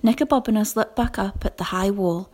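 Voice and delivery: usual speaking voice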